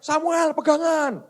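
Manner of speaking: high-pitched mock shout, held-back volume; retold group yell. Everyone on the boat warning Samuel, high mock yelling. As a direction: imitate everyone calling out a warning. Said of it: "Semua teriak".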